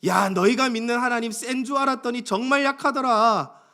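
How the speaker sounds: high, swooping jeer at their God; mocking. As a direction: taunt the defeated with scornful questions. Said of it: "이렇게 지금 조롱하고 있다라는". (높낮이를 크게 흔들며 비웃는 조롱)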